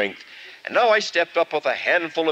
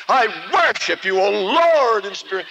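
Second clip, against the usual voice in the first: higher, louder prayer squeezed out mid-kick; strained prayer; 8 semitones higher, 5 dB louder than usual. Acted. Kicking the goat away while praying, strained loud praise. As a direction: pray while fighting off the goat.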